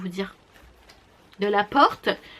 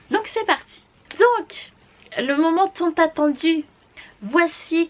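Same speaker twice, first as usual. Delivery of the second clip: excited launch; 7 semitones higher, 5 dB louder than usual. Real, not acted. Beauty vlogger to her viewers, launching the reveal excitedly. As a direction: pitch jumps high for the kickoff.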